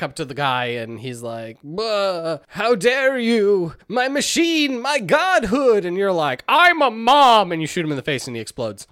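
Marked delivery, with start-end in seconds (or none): 1.6-5.8 mock villain outrage. Retelling the villain's outraged speech. 6.5-7.5 mock triumphant declaration. Hero's retold punchline to villain.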